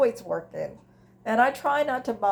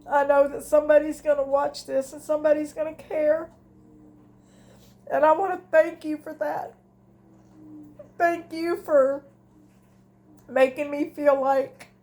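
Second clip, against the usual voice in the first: higher, strained voice, near crying; fighting tears; 6 semitones higher than usual. Real, not acted. Thanking viewers who keep her company, fighting back tears. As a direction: hold back the tears as you thank them. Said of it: "I'm trying not to cry".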